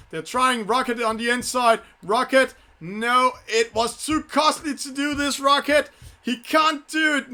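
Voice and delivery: real excited commentary. Real, not acted. Sailing commentator calls the close fight for viewers, excited and high. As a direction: race caller's excitement, pitched well up. Live race climax, penalty fight.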